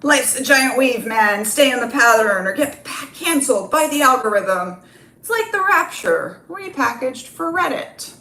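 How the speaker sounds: high, loud know-it-all bro preaching. Acted. Bro character lecturing the audience, high and loud. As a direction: pitch it up like a smug gym bro.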